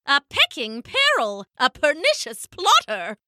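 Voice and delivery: high, fussy elder penguin; high indignant character voice; Pippo. A narrator's reading. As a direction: denounce it with relish on each word. Pippo denounces the thief.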